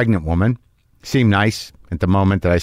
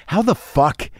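higher, disbelieving mock outrage kept half under the breath; a hushed, higher what-the-fuck of disbelief; incredulous outrage; 5 semitones higher, slower than usual. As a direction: act stunned and furious at the hotel. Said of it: "I'm like".

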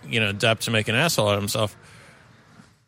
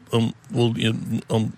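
halting, mumbled filler sounds; mumbly Depp impression; 2 semitones lower than usual. Podcaster attempting the actor's odd accent for a friend, mumbly. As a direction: lightly imitate a mumbling, hesitant speaker. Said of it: "like, mumbly and".

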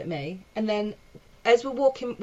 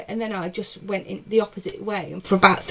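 ordinary talk

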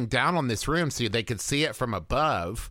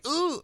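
high anxious ooh. Pretend.

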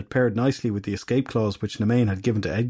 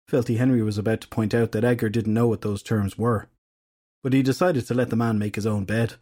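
ordinary delivery, nothing marked

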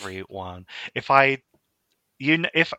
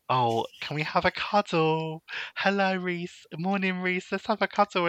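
higher, sugary mock-cuddly voice; mock clingy friend; 8 semitones higher than usual. Mocking friends who beg for cuddles, higher and sugary. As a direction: whine it sweetly to mock them. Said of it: "where they will be like".

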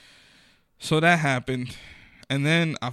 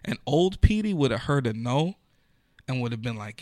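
usual speaking voice

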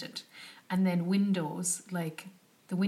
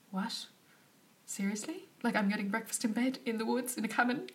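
rising, higher incredulous questions; can't believe what she's hearing; stunned disbelief; 5 semitones higher than usual. Retold or imitated. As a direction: traveller told of breakfast in bed, high stunned disbelief. Offered breakfast in bed.

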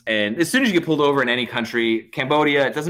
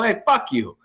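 higher-pitched retold defiance; aggravated; 7 semitones higher than usual. Driver refusing the cop's order to sit, aggravated. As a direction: snap back in mild mock anger. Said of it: "I'm starting to get a little aggravated".

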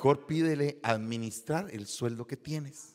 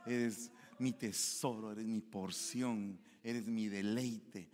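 slow, lingering words of worship; slow devoted prayer; 3 semitones lower, 6 dB quieter, slower than usual. Retold or imitated. Believer praying slowly in the car, devoted. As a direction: savour each word to God.

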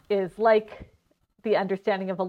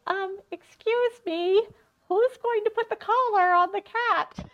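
timid little mouse; 14 semitones higher than usual. Retold or imitated. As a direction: ask it timidly like a tiny polite mouse. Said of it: "And then the mice are like".

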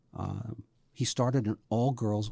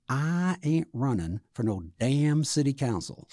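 slow, higher imitated Southern drawl; Charlie's Southern accent; 4 semitones higher, 3 dB louder, slower than usual. Local man refusing to run for council, slow Southern accent. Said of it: "I will imitate this one".

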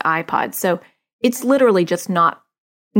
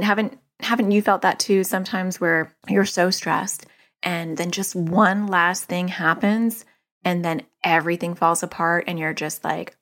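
ordinary delivery

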